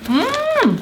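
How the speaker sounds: high squeal of yum; delighted high mm. Real, not acted. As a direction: squeal mmm at the taste. Tasting pears.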